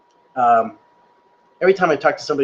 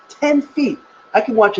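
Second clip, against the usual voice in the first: excited; 9 semitones higher than usual. Real, not acted. Reveals projector size.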